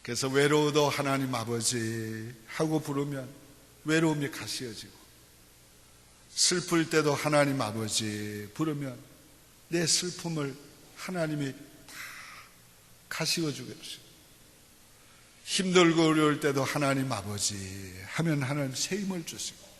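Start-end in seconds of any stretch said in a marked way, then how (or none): 0.9-2.3 low, slow, soft call to the Father; tender call. Retold or imitated. Preacher recalling calling God when lonely, tender slow call. 7.1-8.5 tender call. Retold or imitated. Preacher recalling calling God when lonely, tender slow call. 16.6-17.9 low, slow, soft call to the Father; tender call. Retold or imitated. Preacher recalling calling God when lonely, tender slow call. Retold prayer call in hardship.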